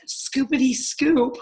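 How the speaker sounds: drawn-out playful chant; slow singsong. Retold or imitated. Mom scooping poop before the cleaners see, slow singsong. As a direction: drag it out playfully.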